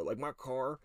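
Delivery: ordinary talk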